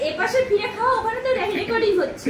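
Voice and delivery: yelling across the room. Real, not acted. Calling to child, recording.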